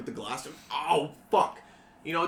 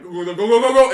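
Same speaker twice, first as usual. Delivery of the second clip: panicked shout; 11 semitones higher, 21 dB louder than usual. Acted. Teens flee from player.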